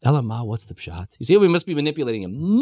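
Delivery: ordinary talk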